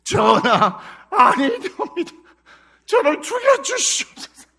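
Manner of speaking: high, loud theatrical entreaty; hammed-up wailing courtier begging the king; Joseon court ministers. Ministers pleading before the king, comic high wail. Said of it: "대신들이".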